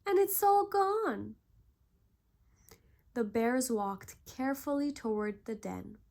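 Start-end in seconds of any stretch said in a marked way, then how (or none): 0.1-1.4 high, loud sulky cub voice; sulking Baby Bear. A narrator's reading. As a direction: whine it like an upset little cub. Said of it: "sulked Baby Bear".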